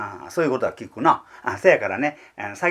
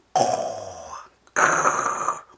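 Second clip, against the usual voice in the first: heron cries; 9 semitones lower, slower than usual. Acted. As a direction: imitate herons crying in the dark. (鷺の鳴き声を真似て)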